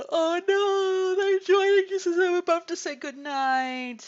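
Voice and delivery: high, loud dismayed wail; a real pained groan that people arrived too late. Real, not acted. Viewers join as she leaves.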